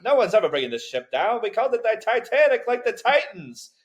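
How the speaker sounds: high, proud mock boast; hammed-up Titanic owner bragging; boastful shipbuilder. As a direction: brag the ship can never sink.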